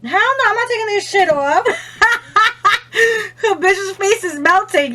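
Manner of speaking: high, loud laughing words; laughing hard. Real, not acted. Vlogger cracking up as her face burns, loud laughing. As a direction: laugh through it, barely holding together. Laughing through burning eyes.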